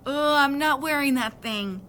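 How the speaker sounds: put-on boy to the ear doctor, loudly refusing the hearing aids; loud groaning refusal. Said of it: "I announced".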